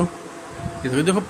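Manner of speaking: hushed whisper, very slow. Real, not acted. Whispering at baby writing. (फुसफुसाकर)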